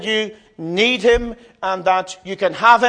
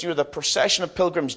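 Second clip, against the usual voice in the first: ordinary talk, nothing marked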